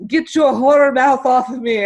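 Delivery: hammed-up grumpy protest; mock coach protest. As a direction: play the coach squirming away. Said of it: "he was like".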